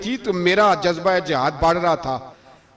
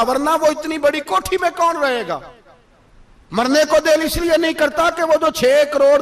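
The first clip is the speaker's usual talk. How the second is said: high, driving sermon voice; impassioned preaching; 9 semitones higher, 4 dB louder than usual. Acted. Preacher challenging worshippers who fear death and hoard money, impassioned. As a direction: press the challenge home in a raised pitch.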